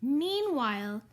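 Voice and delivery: ordinary delivery, nothing marked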